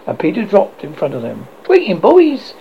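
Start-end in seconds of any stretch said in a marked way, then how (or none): 1.6-2.5 high-pitched hail to the group; cried greeting. A narrator's reading. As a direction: hail them in a raised voice. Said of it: "he cried".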